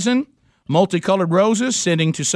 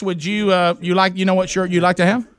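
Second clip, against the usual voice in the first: usual speaking voice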